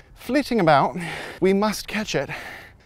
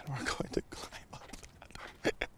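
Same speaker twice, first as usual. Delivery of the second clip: hushed whisper; 2 semitones lower, 16 dB quieter than usual. Real, not acted. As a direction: keep it under your breath. Hushing near a passer-by.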